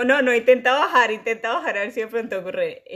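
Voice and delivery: coaxing teacher voice, loud at first; imitating choir teacher. Choir teacher coaxing a new boy, mock encouragement.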